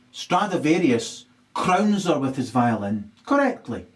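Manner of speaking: ordinary talk, nothing marked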